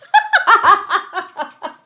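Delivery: high, loud cackling laugh; real laughter bursting out. Real, not acted. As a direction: crack up loudly. Laughing at her own joke.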